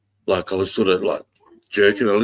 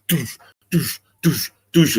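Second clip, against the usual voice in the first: higher, evenly spaced doosh bursts; imitated pulsing boom; 7 semitones higher, slower than usual. Man showing trees pulsing in sync, imitating bursts. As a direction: pulse it out like a beat. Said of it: "They're going".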